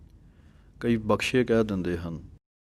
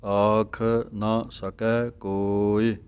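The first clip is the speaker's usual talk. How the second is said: very slow, each word drawn out; slow recitation; much slower than usual. Shown as a demonstration. Teacher shows the way to say the line. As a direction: draw out ਆਖ ਨਾ ਸਕੈ ਕੋਇ. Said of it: "ਇਹ ਢੰਗ ਹੈ ਬੋਲਣ ਦਾ".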